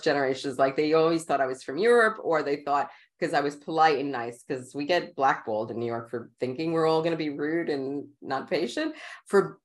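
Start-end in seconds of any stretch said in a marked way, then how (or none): none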